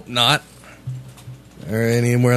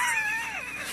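burst of laughter; 11 dB quieter than usual. Real, not acted. Bursts out laughing after the outburst.

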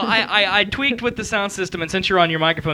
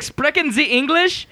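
higher, slower mock German question; retold German; 7 semitones higher, slower than usual. Host retells last week's hotel call, mock German. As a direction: imitate someone asking in German. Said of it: "in a German accent".

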